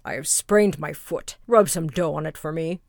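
low, loud wolf voice; sly wolf. Acted. Wolf orders the baker.

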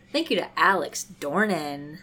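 normal talking voice

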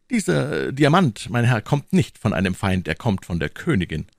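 put-on young hero setting his superior right; young D'Artagnan, protesting. D'Artagnan correcting Tréville about the diamond, emphatic.

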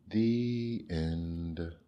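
ordinary delivery

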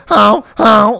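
two loud, high honks; goose talking back. Retold or imitated. Goose answering the drunk man's abuse, loud cheeky honks. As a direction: honk back like a mouthy goose. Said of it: "it's talking shit back".

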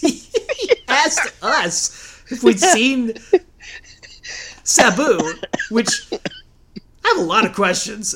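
high, laughing through the words; words breaking into laughter; laughing while talking. Real, not acted.

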